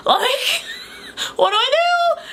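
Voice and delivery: high, laughing cry of panic. Retold or imitated. Vlogger retelling her panic on meeting a fan, laughing high.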